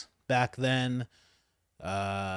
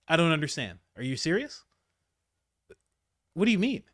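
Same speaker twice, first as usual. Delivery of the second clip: usual speaking voice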